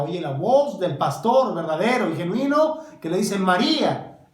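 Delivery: rising to a loud cry of María; loud climax. Acted. Preacher calling María as the shepherd, loud. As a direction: call out the name like the shepherd.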